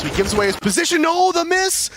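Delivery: high, loud hype call; esports caster. Real, not acted. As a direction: shout the play-by-play. Hype play-by-play.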